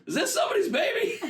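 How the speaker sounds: high-pitched acted call to a crowd. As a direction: imitate calling down a whole queue. Worker calls out to the queue.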